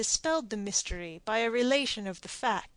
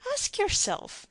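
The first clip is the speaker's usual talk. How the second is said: much higher, challenging; Captain Blood; 12 semitones higher than usual. A narrator's reading. Blood challenges the pirate, lightly voiced. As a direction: toss the question back. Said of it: "said he".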